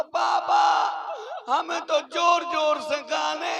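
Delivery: high, loud, slow desperate yell; desperate shout. Retold or imitated. Boy shouts his urgent need at his father, loud and slow. फिर अब हमें और जोर से लगी.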